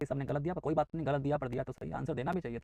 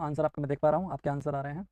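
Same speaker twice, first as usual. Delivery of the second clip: normal talking voice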